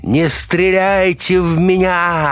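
very high, drawn-out scream; slow, high-pitched panicked shriek; terrified squeal. A narrator's reading. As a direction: squeal it in panic, stretching the words. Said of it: "завизжал человек".

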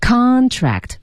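"Contract" stressed CON-tract, high then falling; stress on first syllable. Shown as a demonstration. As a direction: lean hard on the CON of "Contract". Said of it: "我们把重音放在前面了". (Contract 重音在第一个音节，前高后低)

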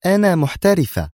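high, edgy declaration; nervy self-assertion. A narrator's reading. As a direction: insist on it, irritated. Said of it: "مستطردة بنفس العصبية". (بنفس العصبية)

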